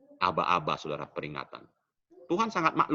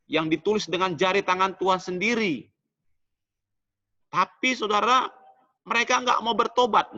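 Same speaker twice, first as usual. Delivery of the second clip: raised, fervent pulpit voice; full-force sermon delivery; preaching; 6 semitones higher than usual. Acted. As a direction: press the point home to the congregation.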